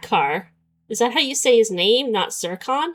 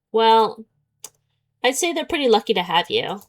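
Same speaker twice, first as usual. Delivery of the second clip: ordinary talk, nothing marked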